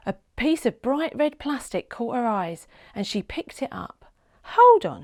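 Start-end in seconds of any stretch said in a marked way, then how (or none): 4.6-5.0 high, loud sudden stop; surprised. A narrator's reading. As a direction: catch yourself, alarmed. Child notices something.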